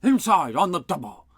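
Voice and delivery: drill-sergeant command; yelling. A narrator's reading. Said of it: "yelled Scrank".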